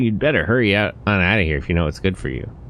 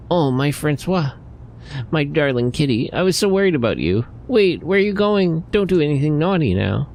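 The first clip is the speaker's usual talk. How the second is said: doting cat lover; 7 semitones higher than usual. Acted. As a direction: coo over the kitty like a smitten owner. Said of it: "the way he changes when he talks to cats".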